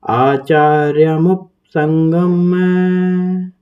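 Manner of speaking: slow chanted Sanskrit recitation; shloka recitation. Shown as a demonstration. Teacher chanting the full verse slowly for students. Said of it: "एक साथ पढ़ेंगे तो इस तरीके से बैठेगा".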